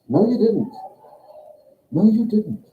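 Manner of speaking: high-pitched, petulant acted denial; insistent contradiction. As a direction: contradict them in a high protesting voice. Acted quarrel, contradicting the speaker.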